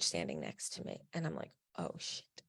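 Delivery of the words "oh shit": breathy whisper of dread; a whispered curse as the coach looms; whispered oh shit. Retold or imitated. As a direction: whisper it under your breath, alarmed. Panicked thought as coach approaches.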